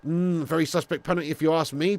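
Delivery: normal talking voice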